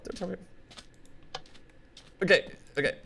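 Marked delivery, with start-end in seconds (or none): 0.0-1.4 very soft slow whispered plea. Pretend. Whispering plea, drawn out and fading. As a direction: barely breathe the words.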